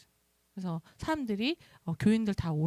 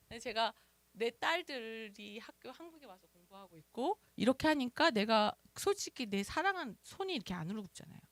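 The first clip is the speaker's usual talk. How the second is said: usual speaking voice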